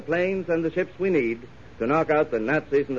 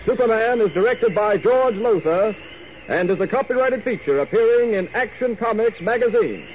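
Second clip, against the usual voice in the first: announcer; 5 semitones higher than usual. Acted. Closing announcement.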